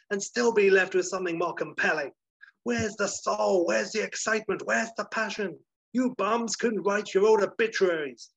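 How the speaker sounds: high, loud, hammed-up studio boss tirade; Flugelman shouting. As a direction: tear into terrified writers with blustering outrage. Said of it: "he's shouting at some Ponzi types".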